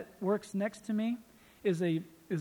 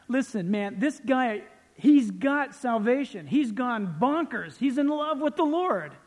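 raised, higher-pitched preacher's enthusiasm; fervent preaching; 6 semitones higher, 5 dB louder than usual. Real, not acted. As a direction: champion the new believer with rising fervor. Preaches passionately about new believer.

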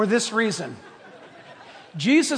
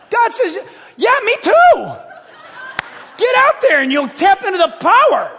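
loud, high, shouting sermon voice; full-force preaching; 7 semitones higher, 5 dB louder than usual. Acted. Pastor urging the congregation to go, in a loud, high preaching shout. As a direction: preach it out with fire.

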